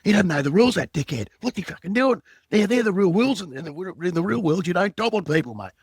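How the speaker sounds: high, loud angry rant; blowing up. Retold or imitated. Still raging to the boss about the caller, loud angry rant. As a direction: vent at the boss, pitch way up. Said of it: "And I'm still blowing up".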